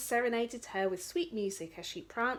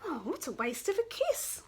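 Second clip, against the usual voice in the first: higher, fed-up sigh; disgust; 8 semitones higher than usual. Acted. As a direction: groan in disgust. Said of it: "said the princess in disgust".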